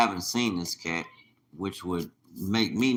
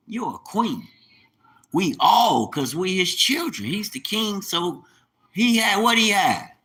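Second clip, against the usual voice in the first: high, loud, rising sermon cadence; fervent preaching; 5 semitones higher, 4 dB louder than usual. Acted. Vlogger preaching to his online critics with fervor. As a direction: preach it like a pulpit climax. Preaches about chosen people.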